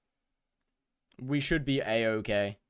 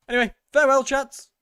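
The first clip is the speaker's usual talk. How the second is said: high, loud goodbye; raised-voice send-off; calling out farewell; 12 semitones higher, 8 dB louder than usual. Real, not acted. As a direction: sing out a big farewell to the crowd. Bids the stream viewers farewell.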